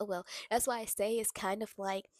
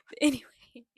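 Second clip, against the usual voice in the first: laughing whisper; 4 semitones higher, 12 dB louder than usual. Real, not acted. Laughing off her rant.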